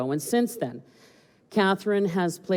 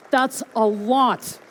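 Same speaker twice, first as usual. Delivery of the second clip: excited; 6 semitones higher, 5 dB louder, slower than usual. Real, not acted. Host hyping fundraising total.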